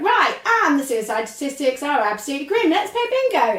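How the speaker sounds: bright jarring jump to bingo, high at the end; chirpy non sequitur after grim news; mock cheery switch. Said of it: "It doesn't really work that well".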